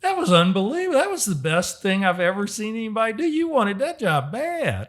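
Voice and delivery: admiring exclamation. Retold or imitated. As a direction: gush like an impressed businessman.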